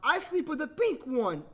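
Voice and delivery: put-on high, slow little-girl whine; pouting little sister. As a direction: insist like a dissatisfied small child. Story sister objects to bear.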